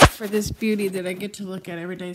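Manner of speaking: ordinary delivery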